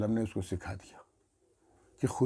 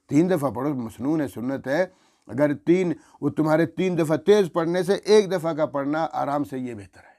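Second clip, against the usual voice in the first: normal talking voice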